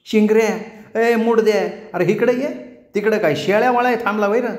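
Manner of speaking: high call across the field. A narrator's reading. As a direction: shout for him from afar. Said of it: "हाक मारली".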